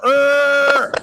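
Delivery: "Er" held long and loud on the R; rhotic R. Shown as a demonstration. Showing off the American R to friends, rhotic "Er". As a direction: drag out a loud, high "Er". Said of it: "We have a rhotic R".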